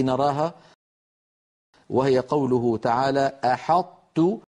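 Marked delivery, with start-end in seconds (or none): 3.4-4.4 "أحطت" with the ط folded into the ت and no bounce; "أحطت" as a Quranic recitation example; partial merging. Shown as a demonstration. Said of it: "هنا أدغمنا حرف القلقلة".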